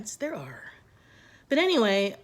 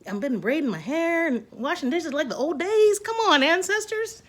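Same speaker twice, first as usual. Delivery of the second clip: mock dramatic plea to ancestors; 7 semitones higher than usual. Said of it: "I'm like".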